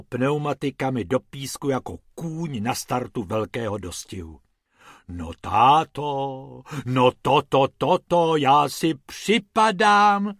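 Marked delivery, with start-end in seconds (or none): 5.1-10.3 slow, drawn-out exclaiming "táto"; amazed exclaiming. Acted. Mrs Pumpernagel exclaims in wonder at the car, slow. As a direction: gasp in awe again, stretched out. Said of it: "zvolala paní Pumpernaglová".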